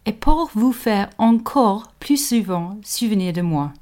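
reading the line in careful French; French quote. Shown as a demonstration. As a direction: give it a proper French pronunciation. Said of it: "In French it says".

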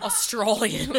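mimicked broad Australian vowel; Australian accent. Echoing a friend's accent attempt, mock Australian accent. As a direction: echo it back in mock Australian. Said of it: "I just stay with the Australian".

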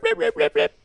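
very high squeaky dolphin clicks; Flipper dolphin chatter. Retold or imitated. Imitating Flipper's dolphin for co-host, very high squeak. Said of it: "that sound that they make in Flipper".

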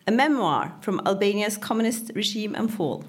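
normal talking voice

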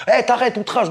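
high squeaky mock police warning; mock cop warning. Mimicking the officer threatening an insult charge, high and mocking. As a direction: parody the cop crying outrage.

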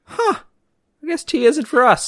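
high squeaky resignation; mock high voice. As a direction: squeak it out, deflated. Retold own reaction to tea.

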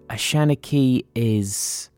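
ordinary delivery

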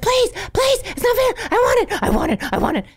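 put-on high, pleading child voice; squeaky kid whining, fading down. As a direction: beg for the cookie like a kid. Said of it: "if they're like".

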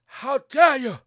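high, loud mock outrage; mock outraged climate activist. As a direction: imitate an indignant young campaigner. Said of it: "Telling folks they can stick".

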